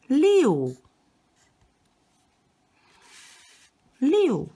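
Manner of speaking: 六 started high and falling sharply; fourth tone. Shown as a demonstration. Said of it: "and it's pronounced".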